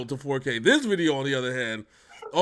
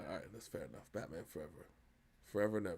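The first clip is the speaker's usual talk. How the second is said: quiet; 9 semitones lower, 18 dB quieter, much faster than usual. Acted. Voice drops, mock spooky.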